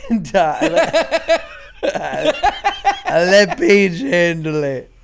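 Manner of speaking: talking through real laughter, loud and slow; laughing. Real, not acted. Dad confessing he leaves night duty to partner, laughing. Cracking up at his own confession.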